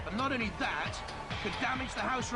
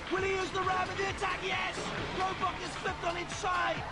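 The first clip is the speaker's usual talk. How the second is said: higher, excited sports commentary; live play-by-play; 11 semitones higher than usual. Real, not acted. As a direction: call the fight live and urgent.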